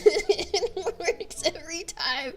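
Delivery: slow, high, laughing words; losing it mid-sentence; breaking into laughter. Real, not acted. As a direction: squeeze the words out through giggles. Breaks up repeating the claim.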